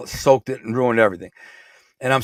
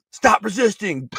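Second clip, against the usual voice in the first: guard voice; 9 semitones higher than usual. Retold or imitated. Guard yells during beating.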